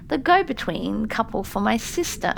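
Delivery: ordinary delivery, nothing marked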